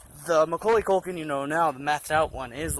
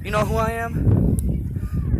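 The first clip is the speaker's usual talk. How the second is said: higher, slow, drawn-out "do you know who I am"; a slow, higher, put-on star's indignation; indignant self-importance; 7 semitones higher, 4 dB louder, much slower than usual. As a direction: lean on your fame, slowly and haughtily. I told him.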